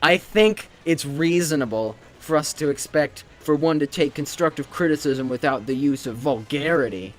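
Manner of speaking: loud, high, prissy lecturing voice; mock a pompous classmate scolding; hammed-up self-righteous reprimand; prim lecture. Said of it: "he's like".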